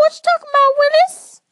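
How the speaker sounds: put-on high, loud squeaky sitcom kid; Diff'rent Strokes catchphrase. Sibling quoting a sitcom line, high loud put-on kid voice. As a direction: belt the old catchphrase like the kid. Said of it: "quoting a TV catchphrase".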